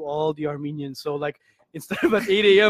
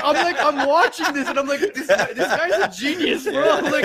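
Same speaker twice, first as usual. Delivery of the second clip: laughing; 9 semitones higher than usual. Retold or imitated. Retells own amazed reaction.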